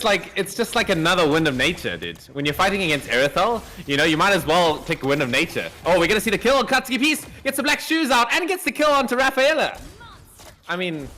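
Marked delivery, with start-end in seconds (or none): 5.8-9.8 high, fast excited call; excited play-by-play. Acted. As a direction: hype the kill live. Caster calls kill excitedly.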